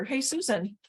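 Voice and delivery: ordinary talk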